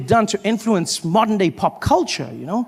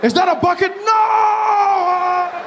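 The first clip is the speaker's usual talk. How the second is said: panicked shouts; shouting; 11 semitones higher, much slower than usual. Acted. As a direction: yell them to stop. Opening acted shouting bit.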